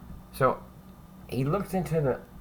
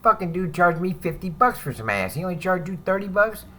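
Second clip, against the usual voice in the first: higher, mildly voiced inmate complaining; a hint of a griping Cuban inmate, pitched up; imitating Nick; 7 semitones higher than usual. Inmate griping to a cellmate about prices, lightly voiced higher. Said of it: "Nick the Greek saying".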